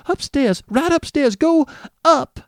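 frightened nurse shooing him away; hysterical nurse. A narrator's reading. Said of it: "she cried hysterically".

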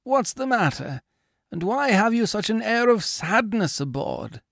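put-on high, anxious older man; worried shipowner. A narrator's reading. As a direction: ask with concern from below the ship. Owner's voice.

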